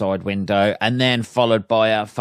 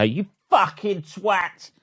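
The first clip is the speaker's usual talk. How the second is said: high, slow mock yell over the shoulder; turning round to shout; 9 semitones higher, slower than usual. Shown as a demonstration. Driver turning round in his seat, hammed-up high yell. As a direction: ham up a shout to the back seat. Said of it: "like that".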